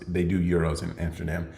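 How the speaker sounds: usual speaking voice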